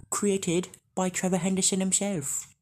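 ordinary delivery

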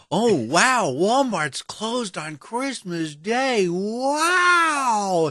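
high, slow, amazed voice; mock amazed. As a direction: gush like a clueless listener. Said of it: "when people go".

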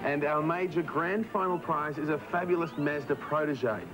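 promo read over music; announcer voice. Acted. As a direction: read the prizes like an ad.

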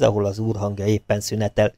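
plain reading voice

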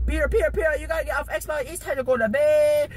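high-pitched nagging mom impression; mom rushing in. Mom rushing in to end Xbox time, mock urgent high voice. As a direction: imitate an urgent mom, stretching the final order. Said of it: "Mom comes rushing in".

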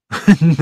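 short amused laugh, voiced lightly; a quick laugh before he answers Jane; narrated laugh. A narrator's reading. Jean laughs at Jane's question, short and amused. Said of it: "He laughed".

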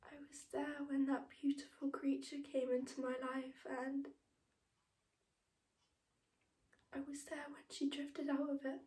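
soft and slightly breath-laden, with long pauses; hushed, reverent remembrance; tender grief. Acted. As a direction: hold the memory gently and quietly. Mother recalling her daughter's death.

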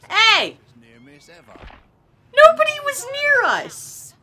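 very high, loud protest; indignant shriek. Real, not acted. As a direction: shriek it in outraged disbelief.

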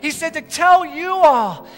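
women insisting to Peter; eager yes. Acted. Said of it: "they said".

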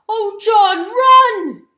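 high, loud desperate scream. Acted. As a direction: scream at him to run. Dog screams at weasel.

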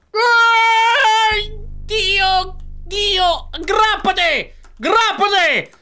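high, loud shrieks of excitement; excited screaming. Real, not acted. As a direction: scream it at the screen. Gamer screaming at a big jump.

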